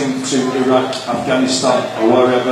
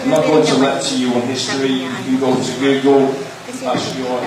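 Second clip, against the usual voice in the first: ordinary talk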